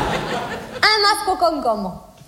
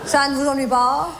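low, deep mock male voice; Jean-Loup's voice; 6 semitones lower than usual. She mimics her date asking a favour in a low mock-male voice. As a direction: drop way down to play the suitor. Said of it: "tu sais ce qu'il me dit?".

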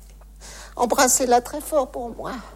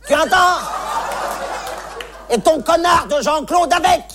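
put-on angry old lady; 3 semitones higher than usual. Old lady insults family.

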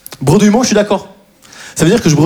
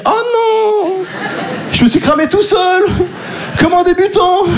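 much higher, laughing dismay; laughing panic; 11 semitones higher, slower than usual. Retold or imitated. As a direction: caught out, laughing at yourself. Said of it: "je me dis". (oh non aigu en riant)

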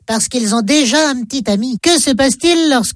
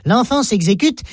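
usual speaking voice

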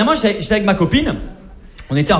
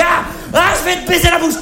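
higher, hammed-up manly yell; mock climax shout; 10 semitones higher than usual. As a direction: blurt it out losing control. He loses control.

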